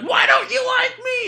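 loud, high drawn-out wail; hammed-up needy screech; crazy ex-girlfriend. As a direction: shriek it like a clingy ex. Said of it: "crazy ex-girlfriend status".

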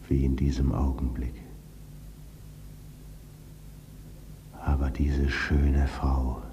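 hushed unvoiced whisper. A narrator's reading. Ghost whispers of his wife's beauty, whispering. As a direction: breathe it out as a secret.

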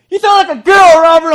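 put-on high, loud taunting girl voice; mocking girl. Girl mocking Robert after a weak dodgeball throw, high loud taunt. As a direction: sneer it like a schoolyard bully girl.

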